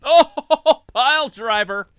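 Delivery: high, loud laughing call; excited shout. Real, not acted. Live excited commentary.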